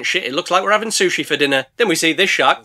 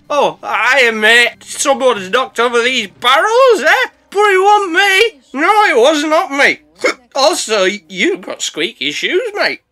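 put-on high, loud, slow drunken protest; drunk denial; 8 semitones higher, 5 dB louder, slower than usual. Drunk boat denies knocking barrels, high loud slurred. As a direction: deny it like a sloshed mate.

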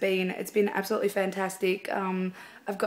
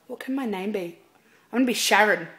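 ordinary talk

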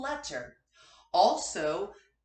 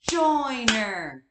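Joyner split into two beats, Joy-ner, louder; syllables clapped; 10 dB louder than usual. Shown as a demonstration. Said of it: "so I would clap".

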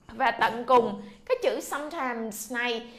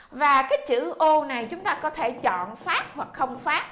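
normal talking voice

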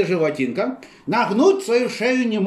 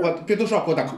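ordinary talk

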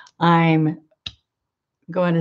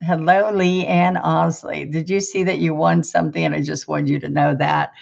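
normal talking voice

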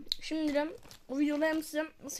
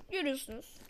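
ordinary talk

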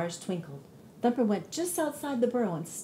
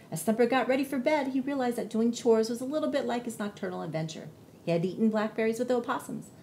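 ordinary delivery

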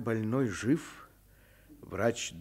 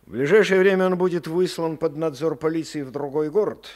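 stern announcement; 8 semitones higher, 10 dB louder than usual. Acted. Colonel announces exile decision.

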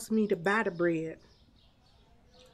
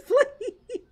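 laugh. Real, not acted. Laughing at own joke.

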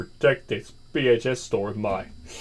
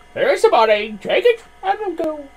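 high put-on customer voice; 13 semitones higher, 4 dB louder than usual. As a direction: blurt it as a customer. Customer voice in game.

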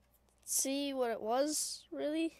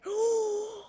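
long, high "ooh" gasp; drawn-out intake of wonder; amazed gasp; 7 semitones higher than usual. Real, not acted. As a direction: gasp at a rare sight. Golden zombie appears.

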